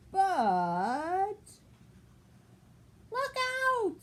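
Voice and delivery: loud, high startled cry of a flustered duck; alarmed squawk. Acted. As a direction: squawk a warning, all in a dither. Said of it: "squawked Missus Mallard, all of a dither".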